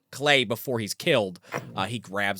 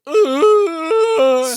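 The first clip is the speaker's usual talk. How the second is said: high, loud, strained creature grunt; hammed-up monster ugh; monster mom growl; 13 semitones higher, 8 dB louder than usual. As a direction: snarl like a caught beast. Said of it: "and she's like".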